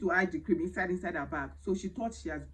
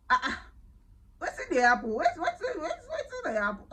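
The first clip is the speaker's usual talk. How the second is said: high, laughing Pidgin exclamation; laughing disbelief; 10 semitones higher than usual. Real, not acted. The vlogger retelling the fight, laughing in high-pitched disbelief.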